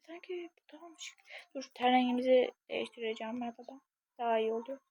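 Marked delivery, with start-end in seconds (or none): 0.1-1.2 secretive whisper to herself; hushed private aside. Real, not acted. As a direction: whisper it so no one hears. Private asides while choosing outfit.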